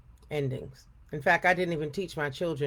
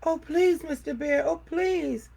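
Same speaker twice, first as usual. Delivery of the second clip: much higher frightened begging; pleading cry; 10 semitones higher, slower than usual. A narrator's reading. Frightened girl pleading with Papa Bear, high begging. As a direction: beg the bear for mercy. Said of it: "cried Afrotina".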